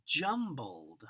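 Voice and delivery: plain reading voice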